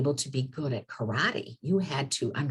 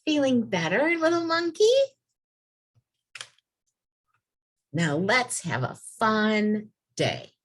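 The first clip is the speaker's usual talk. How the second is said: much higher, cheerful sing-song read-aloud; bright storybook question; 9 semitones higher, 3 dB louder than usual. A narrator's reading. Teacher reading the happy ending to the kids, much higher and bright.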